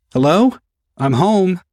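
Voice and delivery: high, loud hello to the house; calling out. Acted. Son arriving home calls out to parents, loud and high. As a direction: call out cheerfully on coming in.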